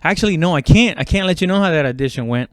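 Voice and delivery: ordinary talk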